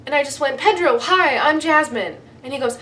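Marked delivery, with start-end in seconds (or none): 0.6-2.2 bright, high-pitched eager hello; excited greeting. Retold or imitated. Starstruck fan greeting Pedro, excited. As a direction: gush out the introduction.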